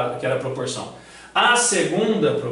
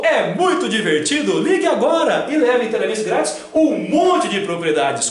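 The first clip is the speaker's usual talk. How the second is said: infomercial; 7 semitones higher, 5 dB louder than usual. Pretend. Paródia de infomercial.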